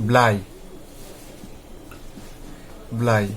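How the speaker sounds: "ব্লাই" said as blai, with an eye vowel; "ব্লাই" repeated after a pause, ending on ai; vowel demonstration. Shown as a demonstration. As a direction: say "ব্লাই" as a separate word, I sounded like its letter name. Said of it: "আয়ের উচ্চারণটা আই হবে".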